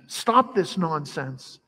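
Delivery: ordinary delivery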